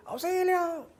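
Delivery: high, soft echo of the cry for help. Retold or imitated. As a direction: let it come back thin, like a canyon echo. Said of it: "escucha un eco". (eco agudo y suave del grito)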